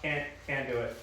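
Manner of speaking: usual speaking voice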